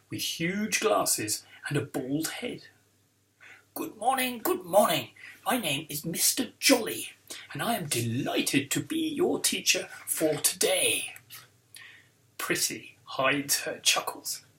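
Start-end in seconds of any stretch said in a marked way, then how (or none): 3.7-11.1 jovial posh TV-presenter voice; plummy, theatrical teacher's welcome; posh supply teacher. Acted. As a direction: greet the class like someone posh off the telly. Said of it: "He sounds like somebody posh off the telly".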